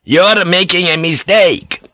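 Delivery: high, loud, slow angry warning; storming. A narrator's reading. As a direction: storm at him, stretching the words. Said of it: "Ferriera stormed".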